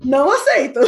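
loud, playful refusal on the edge of laughter; hammed-up indignation; mock indignant refusal. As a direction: refuse loudly, about to laugh.

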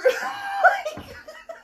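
very high, loud shrieking laughter. Real, not acted. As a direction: burst into a screaming laugh. Cracking up after own yell.

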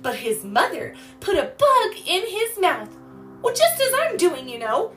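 put-on high, lively explaining to a child; animated storytelling. A narrator's reading. Girl feeding little sister while telling of chick, animated.

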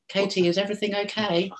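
very high, put-on nosy neighbour voice; high-pitched caricature of a fussing neighbour; mock concerned neighbour. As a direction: call over sweetly in a hammed-up high voice. Neighbour in dressing gown calls over.

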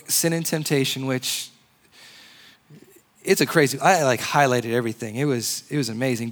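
ordinary talk, nothing marked

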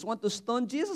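ordinary delivery

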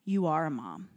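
quiet, firm comfort; gentle reassurance. Retold or imitated. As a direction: imitate a calm, motherly helper. Woman calms panicking mother.

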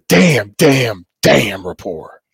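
slow, low, stretched out "damn, damn report"; lazy show-title drawl; drawn-out segment title. Acted. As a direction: intone the segment name like a jingle. Hosts introduce the segment title.

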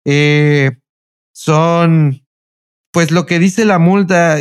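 slow, halting, with long gaps; imitated hesitant stalling. Clerk checking her phone for the speed, imitated as hesitant stalling. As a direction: stall for time between words.

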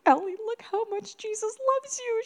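put-on high, thrilled parent-to-child voice; excited parent. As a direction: marvel at a gift for a little girl. Parent excitedly showing child gift.

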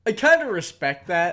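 mock admiration, said loud and high. Real, not acted. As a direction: say it with a sneer. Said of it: "I'm saying it mockingly".

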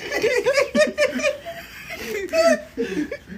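high, loud burst of laughter; long laugh. Real, not acted. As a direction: crack up loudly and keep going. Cracking up at own slur joke.

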